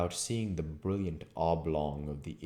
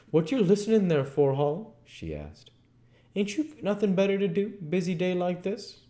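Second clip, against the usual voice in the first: fast, high nagging scold; scolding landlady; 14 semitones higher, 6 dB louder than usual. Acted. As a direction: nag him for idling. Landlady scolding her husband.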